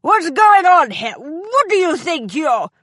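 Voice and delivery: indignant shock at the big footman; startled outrage. A narrator's reading. Enraged man startled by Ambrose.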